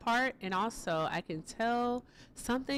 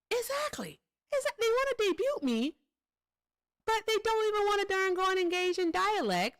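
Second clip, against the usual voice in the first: much higher, incredulous squeal of exasperation; fed-up streamer squealing at the chat; exasperated outburst; 12 semitones higher than usual. Real, not acted. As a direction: throw up your hands vocally, way up high. Exasperated rant about Trump supporters.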